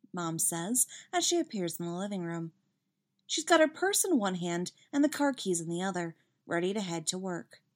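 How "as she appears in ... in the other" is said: usual speaking voice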